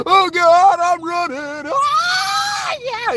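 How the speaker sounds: loud, high strained yelling; mock rock singer. Imitating the singer's wild yelps, high and loud. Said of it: "He goes way higher than I could get".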